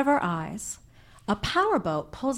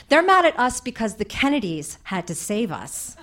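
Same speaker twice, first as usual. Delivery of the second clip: ordinary delivery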